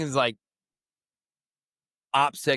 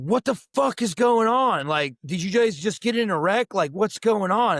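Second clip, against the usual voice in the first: higher, louder panicked question; alarmed; 7 semitones higher, 3 dB louder than usual. Retold or imitated. Father hearing his family scream on the phone, sounding alarmed. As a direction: ask like you fear a car wreck.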